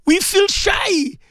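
high, pleading cry to God; imploring wail with stretched-out words; tearful prayer. Real, not acted. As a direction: plead through real tears, with pauses to gather yourself. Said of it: "I say this in tears".

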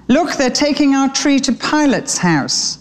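high-pitched excited little bird; young sparrow exclaiming. A narrator's reading. Harrow tells his family about their tree, high-pitched alarm. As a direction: call it out high, as news to the family.

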